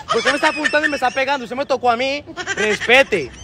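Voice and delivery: normal talking voice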